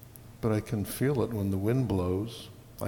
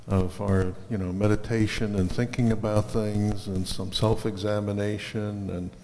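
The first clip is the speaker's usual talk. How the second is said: usual speaking voice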